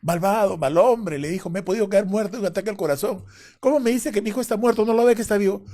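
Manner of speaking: mother yelling at the doctor; angry scolding. Retold or imitated. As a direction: tear into the doctor. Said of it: "Mi mamá le quería pegar".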